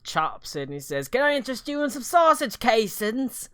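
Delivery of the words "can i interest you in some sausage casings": high, cockney street hawker; cockney sausage seller. Acted. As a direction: hawk sausage casings like a London market seller.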